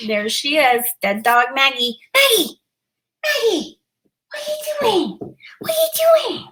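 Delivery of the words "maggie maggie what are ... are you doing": very high doggy-talk voice; calling the dog. Real, not acted. Calling her dog over.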